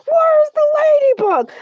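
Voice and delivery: high, loud demand for the Ladybug; outraged shout. Acted. Shark host demands the missing Ladybug, outraged shout.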